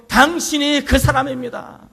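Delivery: loud, high cry of accusation; fiery accusation. Acted. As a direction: call out the verdict on the king. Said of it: "갑자기 불같이".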